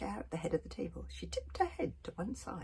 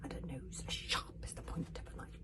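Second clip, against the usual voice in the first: usual speaking voice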